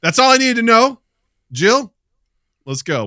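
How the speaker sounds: mock exit call. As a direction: announce you're leaving, half in jest. Imagined walking out on family.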